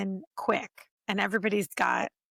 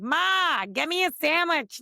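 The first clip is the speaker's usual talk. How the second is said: put-on broad Philly accent, higher and drawn out; 5 semitones higher, slower than usual. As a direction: call out to Mom in thick Philly. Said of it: "pretty big in the Philly accent".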